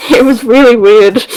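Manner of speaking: laughing as you say it; real laugh spilling round the words; amused. Real, not acted. Laughs at the weird line.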